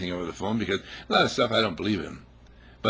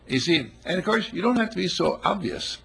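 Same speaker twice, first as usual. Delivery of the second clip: ordinary talk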